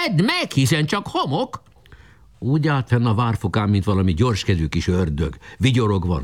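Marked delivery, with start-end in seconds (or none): 0.1-1.6 mocking shout. A narrator's reading. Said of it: "kiáltotta".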